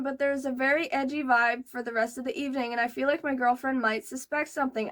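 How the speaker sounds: ordinary delivery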